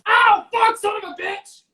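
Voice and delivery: sudden yelp of pain, then cursing. Real, not acted. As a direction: cry out from a burn. Burns finger on baste.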